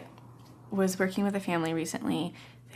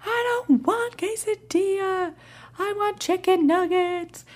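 put-on high-pitched, drawn-out kid tantrum; mock tantrum wail, stretched out; screaming four-year-old; 13 semitones higher, 6 dB louder, slower than usual. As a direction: mimic a child screaming at dinner, high and loud. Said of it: "Four-year-old starts screaming".

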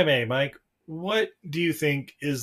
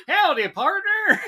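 much higher, slow, laughing howdy; a giggly, put-on cowboy drawl; mock cowboy; slower than usual. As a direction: ham up a cowboy greeting through laughter. Jokey cowboy voice amid laughter.

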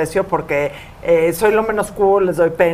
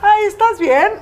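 high mock-sweet concern; mocking sweetness; 9 semitones higher than usual. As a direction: imitate the syrupy question. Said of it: "y me dices". (dulzura burlona aguda)

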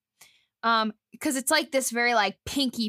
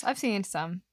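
ordinary talk, nothing marked

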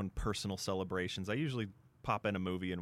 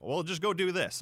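mock armchair advice; 9 semitones higher, 4 dB louder than usual. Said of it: "I can sit here and say".

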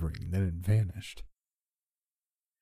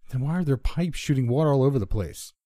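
loud, raised-pitch challenge; narrated shout; 6 semitones higher, 5 dB louder than usual. A narrator's reading. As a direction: shout the question at him. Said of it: "I shouted at him".

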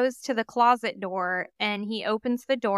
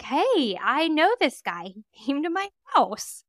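higher, eager voice of the caller; excited caller; 5 semitones higher than usual. Retold or imitated. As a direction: play her recognizing him, high and bright. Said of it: "she was like".

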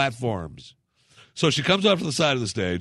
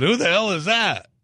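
raised pitch and louder, an impressed outburst; hammed-up leering surprise at a woman entering; mock Trump exclamation; 8 semitones higher, 4 dB louder than usual. Trump on Stern, recalling a first sight, mock excited outburst. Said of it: "and I said".

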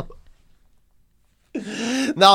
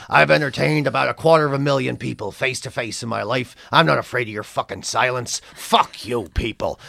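much lower, gruff-edged Canadian comic voice, weary and done with everything; Mike Wilmot's Canadian accent; 6 semitones lower, 4 dB quieter than usual. Retold or imitated. A veteran Canadian comic sneering about a young act, in a low Canadian accent. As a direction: do the jaded old Canadian comedian. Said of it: "I'll do it in his accent".

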